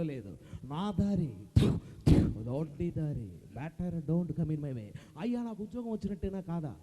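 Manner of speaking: low, slow swagger in chopped phrases; stylized star bravado; Rajinikanth impression. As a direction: deliver it as punch dialogue with pauses. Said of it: "super star Rajinikanth గారు ఇట్లే వస్తున్నారు".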